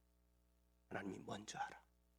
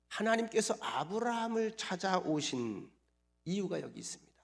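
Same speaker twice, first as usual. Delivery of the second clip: usual speaking voice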